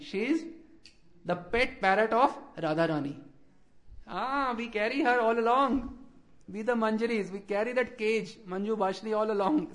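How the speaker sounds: ordinary talk, nothing marked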